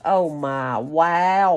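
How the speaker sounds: ordinary talk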